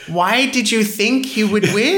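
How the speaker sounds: mocking partner. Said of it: "She's like".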